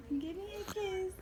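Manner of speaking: put-on high, soft baby voice; voicing the baby. Mother voicing the baby to daddy, high and soft. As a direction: ask for a kiss in a tiny voice.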